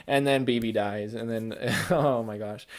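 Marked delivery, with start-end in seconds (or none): none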